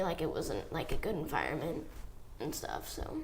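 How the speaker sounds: trailing off, fighting back tears; fresh grief. Real, not acted. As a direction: voice sinking into quiet sadness. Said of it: "It's hard, yeah, when you're torn".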